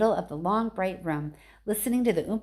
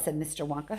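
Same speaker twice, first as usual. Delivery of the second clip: usual speaking voice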